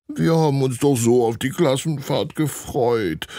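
lightly voiced child, a touch of tearfulness; a boy near tears about the trip; tearful. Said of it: "fügt Lennart weinerlich hinzu".

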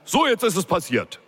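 high, bellowing boss; an exasperated boss roaring into the phone. A narrator's reading. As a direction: yell it down the line at her. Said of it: "brüllte er direkt durch die Leitung".

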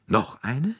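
high, rising incredulous question; blurted out. A narrator's reading. Director hears of another witness, blurting in disbelief. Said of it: "stieß Monsieur Bouc hervor".

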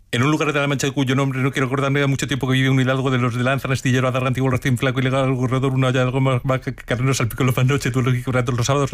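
leer atropellado y monocorde, sin pausas; recitado rapidísimo. Shown as a demonstration. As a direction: recitar de carrerilla, a toda velocidad. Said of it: "si tuvieras que hablar mucho más rápido". (lectura a velocidad de trabalenguas)

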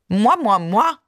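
hammed-up French airs; playful French affectation; pursed-lip mock French. A narrator's reading. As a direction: purse your lips and put on haughty airs. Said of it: "mit erhobenem Kinn und gespitzten Lippen".

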